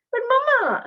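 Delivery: put-on high, loud child's protest; whiny child. A child pleading with her mother over chocolate, high whiny protest. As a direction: whine it like a kid denied chocolate.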